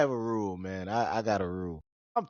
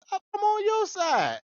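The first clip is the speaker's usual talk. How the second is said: pitched-up pleading protest; high protest. Retold or imitated. Son to his stepmother, high pleading protest. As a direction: squeak it out, wounded. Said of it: "I'm like".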